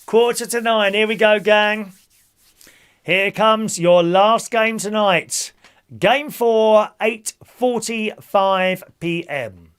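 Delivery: loud, sing-song number calling; bingo caller. Acted. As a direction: call the numbers to the hall. Calls bingo numbers to players.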